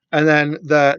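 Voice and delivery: usual speaking voice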